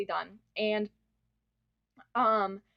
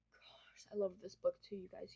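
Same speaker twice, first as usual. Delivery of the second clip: excited whisper; 2 semitones lower, 11 dB quieter than usual. Real, not acted. Gushing whisper over beloved book.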